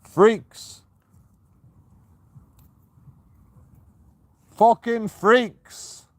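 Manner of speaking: loud, high shout of freaks, the second one slow; furious yell into the darkness; angry shout. Real, not acted. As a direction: yell it at them in disgust. Yelling at people in the dark.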